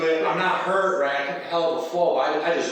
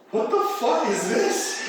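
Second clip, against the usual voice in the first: slow, higher puzzled voice of the truck owners; lightly voiced baffled neighbour; bewildered bystanders; 5 semitones higher, slower than usual. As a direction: play the confused onlookers, drawn out. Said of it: "and they're like".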